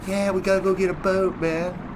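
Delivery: high, dismissive sing-song parody; mock their words back at them; sneering mimicry of a teammate. Sneering repeat.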